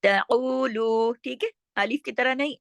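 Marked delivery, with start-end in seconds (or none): 0.0-1.1 slow ताउलू with throaty second syllable; ain from throat. Shown as a demonstration. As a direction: press ain in ताउलू, hold both long vowels. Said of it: "ऐन को हलक से पढ़ा जाएगा।". (ताउलू, ऐन हलक से)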